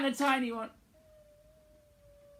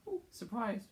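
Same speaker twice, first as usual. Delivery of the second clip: mock surprised; 4 semitones higher, 10 dB quieter than usual. Shown as a demonstration. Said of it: "You have to go".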